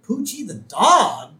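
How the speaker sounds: high, loud, slow puzzled reading; surprised boy reading the headline. Retold or imitated. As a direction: drag it out in disbelief. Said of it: "he goes".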